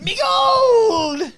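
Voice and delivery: high, loud leprechaun cry; shouted leprechaun. Acted. Hosts riffing on the movie, leprechaun shouting for his gold. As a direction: howl for your gold like a leprechaun.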